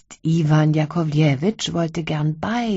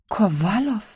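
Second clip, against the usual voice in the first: ordinary delivery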